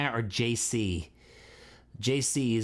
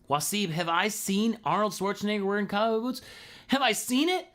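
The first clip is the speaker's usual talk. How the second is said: excited; 8 semitones higher than usual. Real, not acted. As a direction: play up the surprise at the question. Excited reply to viewer question.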